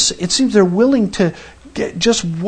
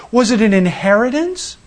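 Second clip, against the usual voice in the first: normal talking voice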